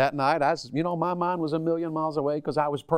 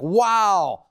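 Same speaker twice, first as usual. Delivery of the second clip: a loud, far-off mock wow; hammed-up awe; mock amazed wow; 6 semitones higher, 7 dB louder than usual. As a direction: gasp it like a dazzled listener. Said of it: "you'd be like".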